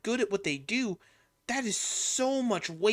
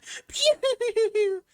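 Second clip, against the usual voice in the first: high maniacal laugh; 9 dB louder than usual. Shown as a demonstration. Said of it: "super high-pitched".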